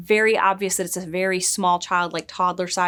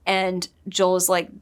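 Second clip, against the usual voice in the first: usual speaking voice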